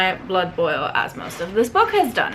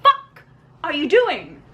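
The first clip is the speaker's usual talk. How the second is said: outraged disbelief; 8 semitones higher than usual. Real, not acted. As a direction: yell your disbelief at the character. Reviewer outraged at book.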